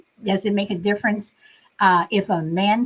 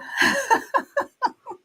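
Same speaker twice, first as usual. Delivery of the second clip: high giggle; 13 semitones higher, 3 dB quieter than usual. Real, not acted. Laughing after reassuring Shelby.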